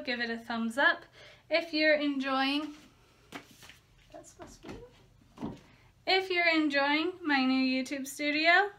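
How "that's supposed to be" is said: very soft, barely voiced aside; hushed aside. Real, not acted. Vlogger breaking off the sign-off, hushed aside. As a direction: drop to a near-whisper for yourself.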